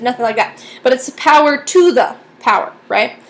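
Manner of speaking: ordinary talk, nothing marked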